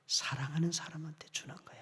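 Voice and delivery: soft unvoiced whisper; tender whispered promise. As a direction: hush God's promise. God's words whispered.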